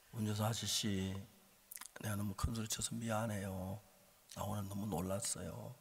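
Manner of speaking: humbly smooth it over with the driver; quiet, composed owning-up; gentle apology. Retold or imitated. Retold apology after changing mind.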